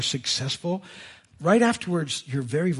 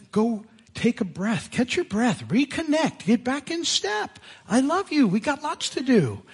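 encouraging God; 7 semitones higher than usual. Acted. Voicing God encouraging Elijah.

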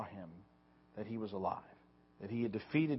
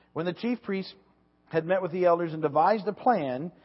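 loud, deliberate Bible reading; scripture reading; 3 semitones higher, 6 dB louder than usual. Acted. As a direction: proclaim the passage to the congregation. Reads Scripture aloud.

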